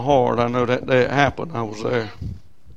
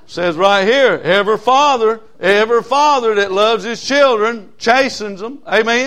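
loud, higher scripture proclamation; preaching at full force; 7 semitones higher, 8 dB louder than usual. Acted. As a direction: proclaim the verse to the pews.

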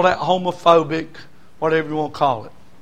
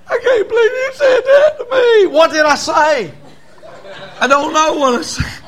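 high laughing falsetto wife; mock wife; 5 dB louder than usual. Preacher mimicking a wife's outrage in a high laughing voice. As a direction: squeal it like a flustered wife, laughing. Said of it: "Women look at us sometimes and say".

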